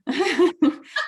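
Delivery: bright amused laugh up high. Real, not acted. Guest laughs about hiding her hand under her scarf. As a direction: laugh lightly at yourself.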